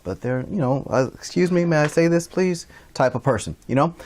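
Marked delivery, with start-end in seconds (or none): 1.2-2.7 high, hesitant, polite little plea; higher-pitched and meekly deferential; timid, unsure speaker. Acted. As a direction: ask permission as if you lack all authority. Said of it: "someone who doesn't really know what they're talking about".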